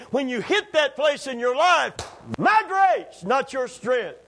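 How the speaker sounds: high, loud, building proclamation; shouting God's words at full force; preaching shout. Acted. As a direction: hammer home my grace each time.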